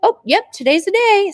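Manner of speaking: high, bright mock excitement; excited self-quote. To husband hearing chicks, high excited reply. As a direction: chirp it up high like it's news. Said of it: "I'm like".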